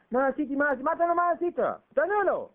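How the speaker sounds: loud mock. Mocking then urging.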